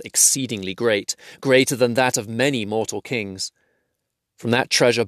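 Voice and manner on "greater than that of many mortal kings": plain reading voice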